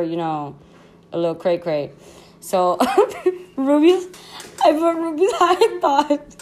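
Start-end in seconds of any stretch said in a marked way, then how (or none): 3.2-6.4 high, loud, laughing through the words; helplessly amused, pitched way up; cracking up. Real, not acted. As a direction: struggle to read it out through giggles. Cracking up reading own tweets.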